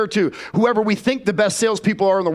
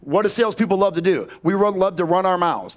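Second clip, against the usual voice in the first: usual speaking voice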